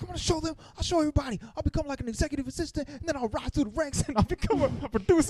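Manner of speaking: high-pitched hammed-up breakdown; mock crying. Max breaking down at home, in mock tearful defiance. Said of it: "breaking down, crying".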